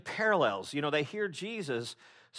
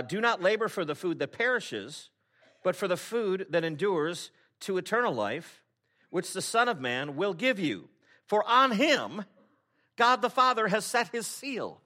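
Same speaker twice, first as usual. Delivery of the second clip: scripture read with rising force; 5 semitones higher, 3 dB louder than usual. Real, not acted. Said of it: "He says".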